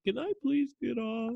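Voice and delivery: high, soft mock plea; pleading. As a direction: beg meekly in a small high voice. Begging to leave the flight.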